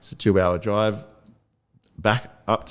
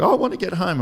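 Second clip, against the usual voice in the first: high, whiny plea to get home; whiny wife; 9 semitones higher, faster than usual. Retold or imitated. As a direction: voice her whining, pitched up. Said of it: "She's like".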